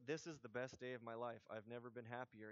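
low, quiet deadpan; boring, emotionless. Pretend. Preacher showing life without emotions, bored deadpan. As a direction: declare great joy with no emotion at all. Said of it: "It would be really boring".

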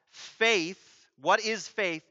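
usual speaking voice

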